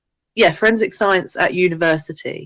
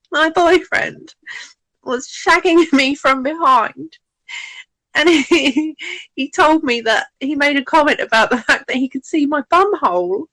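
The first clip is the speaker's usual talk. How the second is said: upset friend; 9 semitones higher than usual. Retold or imitated. Friend explaining what her boyfriend said, mock upset. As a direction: tell it like a wounded friend, hammed a little.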